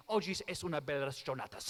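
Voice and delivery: jokey Spanish version of the Italian line; mock Spanish. Shown as a demonstration. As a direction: fake the Spanish by tacking an s onto the Italian. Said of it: "If you want to speak Spanish".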